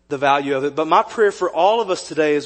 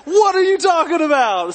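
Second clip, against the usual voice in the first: high, loud incredulous disbelief; incredulous what; 10 semitones higher, 3 dB louder than usual. Retold or imitated. Husband reacting to wife not knowing Field of Dreams, high shocked disbelief. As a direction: squawk it out in mock shock. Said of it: "And I'm like".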